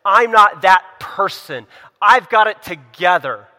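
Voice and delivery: loud, slow, puffed-up boast; boastful. Retold or imitated. Preacher mocking the proud churchgoer, loud slow boast. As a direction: brag that you've got it all together. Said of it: "to not be boastful and say".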